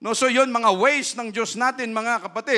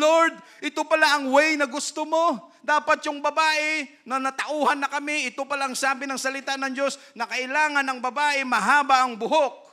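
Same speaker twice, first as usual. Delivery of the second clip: voiced convert's prayer; 6 semitones higher, 4 dB louder than usual. Voicing converted women praying.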